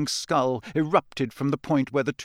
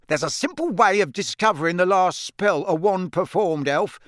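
stern official; 6 semitones higher, 3 dB louder than usual. Acted. Diggory threatening the elf.

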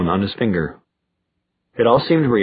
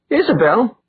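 croaked; 11 semitones higher than usual. A narrator's reading. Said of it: "Alec croaked".

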